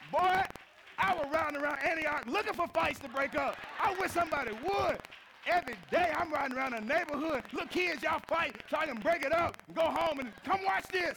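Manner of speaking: high, loud eager bragging; high, loud mock eagerness; hyped boasting. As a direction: ham up the eager hero. Mocking his eager fighting days.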